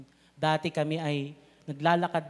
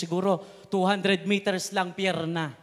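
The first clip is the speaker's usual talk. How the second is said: normal talking voice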